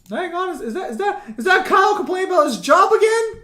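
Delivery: high, loud teasing call; teasing shout. Real, not acted. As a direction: tease him loudly, pitch up high. Teasing friend's complaining.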